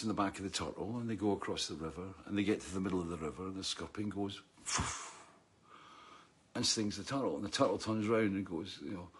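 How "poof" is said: soft, voiceless puff of air; imitated sting noise. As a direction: blow out a short airy whoosh for the sting.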